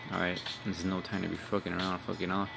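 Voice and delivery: normal talking voice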